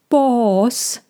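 alarmed. Acted. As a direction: warn the boss, a touch anxious. Frightened horse warns his boss. (leicht beunruhigt)

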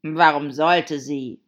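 loud, slow grumble; grumbled. Acted. Hatter defending his watch, grumpy and drawn out. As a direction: grumble back emphatically. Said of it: "murrte der Hutmacher".

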